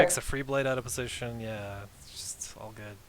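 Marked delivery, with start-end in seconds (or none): 1.4-3.0 whispered aside. Real, not acted. Aside under the co-caster.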